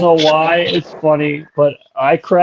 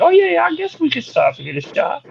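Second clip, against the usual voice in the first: very high, squeaky impersonation; high-pitched and dead serious; Beetlejuice; 13 semitones higher, 3 dB quieter than usual. Retold or imitated. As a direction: agree earnestly in a high voice. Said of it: "There's no irony whatsoever".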